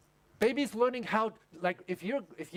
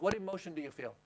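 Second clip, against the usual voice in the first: normal talking voice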